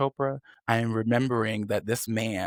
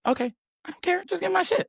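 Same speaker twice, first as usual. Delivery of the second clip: mock couldn't-care-less; faster than usual. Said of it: "And I said".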